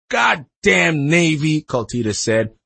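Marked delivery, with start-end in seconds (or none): 0.1-1.6 loud, very slow, higher curse; drawn-out, emphatic outrage; angry cursing. A narrator's reading. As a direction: spit out each word in indignation. Angry mother curses the Navy.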